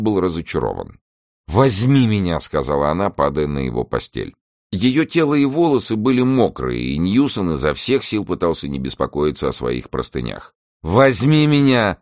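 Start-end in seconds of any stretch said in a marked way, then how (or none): 1.5-2.4 raised-pitch, brief insistent request; insistent demand. A narrator's reading. Character makes a first demand. 10.8-12.0 high, loud, drawn-out demand; slow it down and insist loudly; emphatic repeated demand. A narrator's reading. Character repeats the demand more forcefully.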